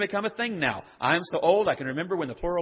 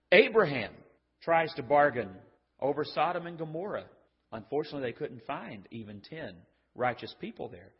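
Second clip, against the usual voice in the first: ordinary talk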